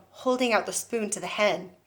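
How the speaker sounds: plain reading voice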